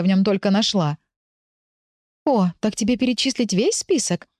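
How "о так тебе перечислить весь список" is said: teasing. A narrator's reading. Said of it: "подколол меня внутренний голос".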